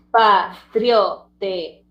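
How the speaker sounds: "Patriotería" slow, pa, trio, te, rí, a; syllable by syllable. Shown as a demonstration. Teacher showing diphthong and hiatus, "Patriotería" syllable by syllable. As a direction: break "Patriotería" into separated syllables. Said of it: "el hiato".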